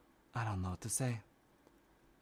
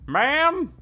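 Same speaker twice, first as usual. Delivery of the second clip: calling out; 12 semitones higher than usual. Acted. Calling at the door.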